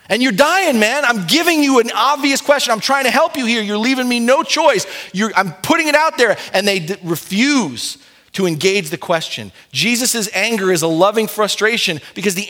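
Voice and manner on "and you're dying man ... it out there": put-on pleading with a stubborn kid, exasperated; exasperated parent.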